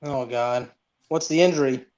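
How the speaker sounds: usual speaking voice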